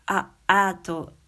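drawn-out vowel sound; vowel sound demo. Shown as a demonstration. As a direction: stretch the vowel out slowly. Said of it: "あだったらなんか". (母音をゆっくり伸ばして発音)

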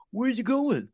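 high-pitched confused question; exaggerated clueless puzzlement; mock bewilderment. Imitating confused chefs left behind.

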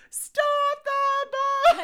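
high loud laughing shout. Pretend. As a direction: yell it like an alarm. Mock call to halt bus.

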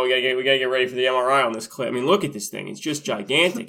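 quick, eager mock doctor; rushed doctor quote. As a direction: rattle it off fast like a keen doctor. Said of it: "And he's like".

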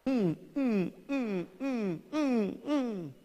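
put-on high whimpering hums of a weeping old man; mock sobbing. Preacher voicing old Samson weeping, high hummed whimpers. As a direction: whimper softly through closed lips. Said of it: "y lloraba otra vez".

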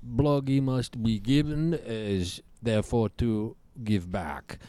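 gruff Eastern European puppet; Bloggy voice. Acted. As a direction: demand a cigarette in broken English.

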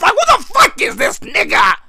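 high-pitched mock outrage; pissed-off judge. Judge fed up with the defendant, pissed-off outburst. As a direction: play the judge exclaiming in high indignation. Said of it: "The fucking judge is pissed off".

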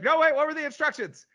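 high, loud mock outburst; loud, high-pitched retold yell; acted exasperated shout. Acted quote of panicked character.

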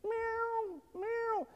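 high, thin imitated kitten meows; cat meows. Imitating the kitten meowing from the teacher's desk, high cat mews. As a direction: mew like a small kitten.

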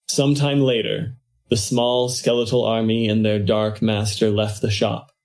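ordinary delivery

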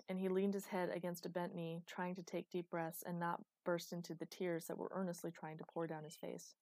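ordinary delivery, nothing marked

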